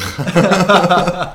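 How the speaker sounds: big high laugh bursting out; loud burst of laughter. Real, not acted. Host cracks up at the heist joke, loud laughing.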